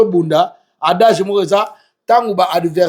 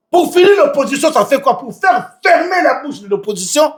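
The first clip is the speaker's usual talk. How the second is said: high, insistent raised voice; shouting; 8 semitones higher than usual. Real, not acted. Guest arguing about silencing the opposition, shouting. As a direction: shout it out with force, pitched high. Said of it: "Mais pourquoi tu cries?".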